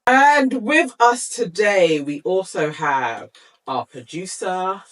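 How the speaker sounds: grand announcer. Acted. Grand introduction.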